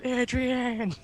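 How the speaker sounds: high, drawn-out comic holler; hammed-up high cry; mock movie yell. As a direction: yell the name like the film boxer. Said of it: "you yell".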